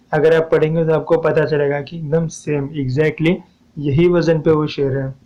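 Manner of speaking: usual speaking voice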